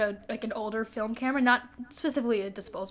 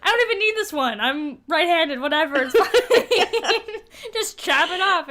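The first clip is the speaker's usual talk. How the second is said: higher, louder laughing shrug; mock dismissive; 6 semitones higher, 5 dB louder than usual. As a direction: toss it off as if nothing matters. Continuing [name]'s mock dismissal.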